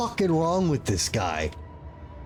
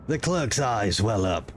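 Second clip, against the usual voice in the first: low, even narrator voice; story narration; 6 semitones lower than usual. A narrator's reading. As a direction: set the scene calmly.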